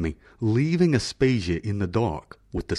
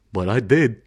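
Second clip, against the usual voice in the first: plain reading voice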